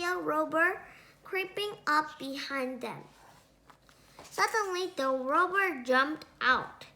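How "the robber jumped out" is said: plain reading voice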